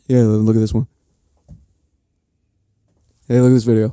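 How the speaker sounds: loud mock call for attention; pushy imitation. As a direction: badger someone to look, loudly. Imitates people pushing their videos.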